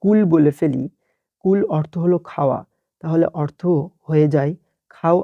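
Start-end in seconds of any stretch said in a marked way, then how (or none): 0.0-0.2 কুল with a plain front k, the mistake; wrong pronunciation. Shown as a demonstration. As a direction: demonstrate the wrong light k in কুল. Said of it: "আর যদি এখানে আমরা কেউ কুল বলে ফেলি".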